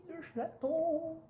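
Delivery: high, slow, soft cooing. Real, not acted. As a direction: coo over the kitten. Cooing at the kitten.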